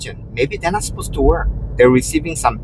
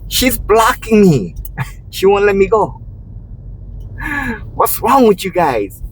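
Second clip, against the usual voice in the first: mock protest; 10 semitones higher than usual. Me protesting to the woman blocking me, high mock complaint. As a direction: play up being blocked. Retelling his own protest.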